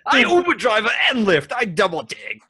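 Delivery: broad caricature cabbie voice, very high; Indiana Jones taxi driver. Acted. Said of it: "the next taxi driver for Indiana Jones".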